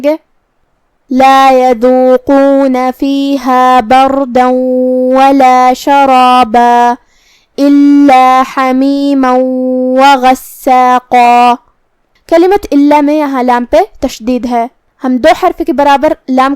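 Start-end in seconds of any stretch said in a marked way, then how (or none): 1.1-11.5 tajweed recitation. Shown as a demonstration. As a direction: recite the full verse melodically, each phrase drawn out. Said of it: "لیکن ہم کلمت شرابہ پہ رکیں گے". (بلند اور دھیمی ترتیل سے آیت کی تلاوت)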